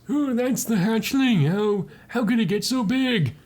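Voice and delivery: high, hammed-up wondering voice; Hardy. Hardy amazed at the hatchling, high hammy wonder. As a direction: marvel in a put-on high voice. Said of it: "And Hardy goes".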